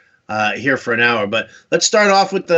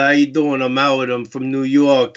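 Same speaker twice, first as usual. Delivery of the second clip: casual New York accent; 4 semitones lower than usual. Shown as a demonstration. Host recalls his old stage-work introduction, New York accent. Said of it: "had to get rid of that accent".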